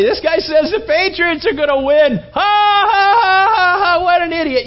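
high, loud jeering that builds into mocking laughter; mocking crowd. Football fans jeer a lone Patriots believer, loud mocking laughter. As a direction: sneer at the fool, then call everyone over to laugh.

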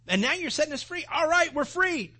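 high, loud and giddy at being freed; excited. Pretend. Preacher imagining himself freed, high and excited. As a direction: burst out thrilled like a freed prisoner.

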